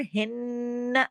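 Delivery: हिन्न with a long nasal n; ghunna. Shown as a demonstration. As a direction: hum on the n of हिन्न before releasing. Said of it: "इसके ऊपर गुन्ना करेंगे". (हिन्न पर गुन्ना करते हुए)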